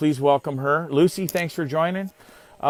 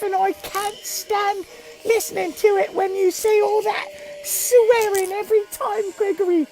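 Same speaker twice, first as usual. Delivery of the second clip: much higher, slow mock mother voice; doing my mom; 3 dB louder, slower than usual. Mom scolding her son about swearing, high mock lecture. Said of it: "I'm doing my mom right now".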